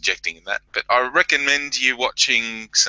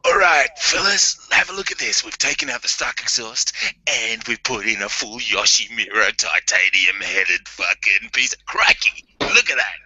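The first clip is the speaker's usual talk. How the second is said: hyped Aussie vlogger. Acted. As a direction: play up an Australian vlogger showing off the bike. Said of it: "if I was to make a vlog and go".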